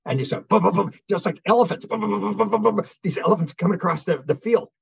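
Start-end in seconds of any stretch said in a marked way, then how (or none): 0.6-2.9 imitated booming footsteps. Imitating giant footsteps approaching.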